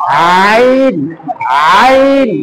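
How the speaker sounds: আইন squeezed from the middle of the throat; throat-pressed ayn. Shown as a demonstration. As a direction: tighten the throat and push out আইন. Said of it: "চাপ দেই দেখি".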